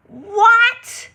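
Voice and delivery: high, loud shocked what; shocked gasp. Real, not acted. Streamer shocked to learn of the new movie, shrieking.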